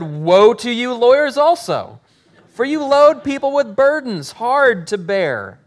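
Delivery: loud, high rebuke; proclaiming woe. Acted. Jesus rebuking the lawyers at dinner, loud and high. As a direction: rise into a thundering denunciation.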